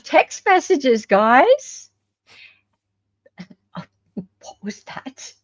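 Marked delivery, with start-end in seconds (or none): 3.4-5.3 laughing whisper. Real, not acted. Laughing aside.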